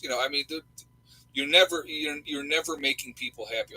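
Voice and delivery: ordinary delivery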